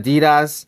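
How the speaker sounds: Adidas as uh-DEE-duhs; Adidas with the stress on DEE; American pronunciation. Shown as a demonstration. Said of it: "We just pronounce it".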